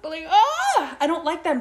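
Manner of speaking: high, loud squeal of disgust; squeamish squeal. Real, not acted. Podcaster squealing at the thought of mice. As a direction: squeal like it's crawling on you.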